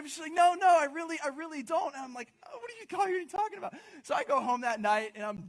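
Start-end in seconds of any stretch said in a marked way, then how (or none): 0.3-2.0 put-on insisting girlfriend. 2.5-3.7 high, stunned protest; shocked disbelief. Retold or imitated. Hearing his girlfriend end it, high shocked protest.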